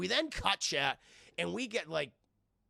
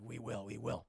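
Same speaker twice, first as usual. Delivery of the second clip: very low gravel-free Batman growl; gruff Batman voice; 7 dB quieter than usual. Retold or imitated. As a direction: drop way down into a Batman voice.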